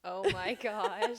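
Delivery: loud, slow excited gasp; drawn-out thrilled oh my gosh. Real, not acted. Excited recognition.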